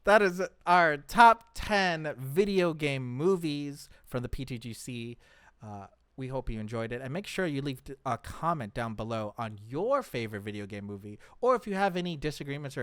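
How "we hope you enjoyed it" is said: ordinary delivery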